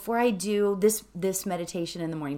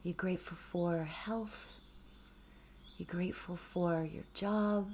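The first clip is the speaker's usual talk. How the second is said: soft, calm meditation voice, sometimes whispered; hushed gratitude meditation; guided meditation; 8 dB quieter than usual. Acted. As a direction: ease listeners inward with gentle questions.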